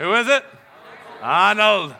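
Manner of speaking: loud, high call out to the crowd; excited host call. Real, not acted. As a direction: fire the question at the audience. Game host quizzing the crowd.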